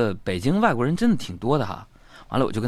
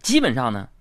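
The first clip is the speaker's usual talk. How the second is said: usual speaking voice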